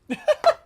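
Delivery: high, loud burst of laughter; shrieking laugh. Real, not acted. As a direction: cackle out loud. Laughs at own story.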